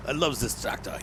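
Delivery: half-hearted fake French accent; bad French accent. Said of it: "I cannot do French accents".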